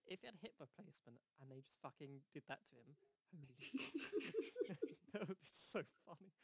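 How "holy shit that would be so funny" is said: real laughter breaking through, much higher and louder; laughing. Real, not acted. Friends joking about the metal hip, laughing. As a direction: crack up while saying it.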